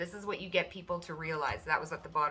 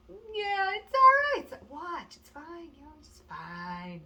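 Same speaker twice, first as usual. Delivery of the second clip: high mock nonchalance; 11 semitones higher, slower than usual. The querent brushes off trouble in a high, breezy mock voice. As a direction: toss it off like nothing matters, up high.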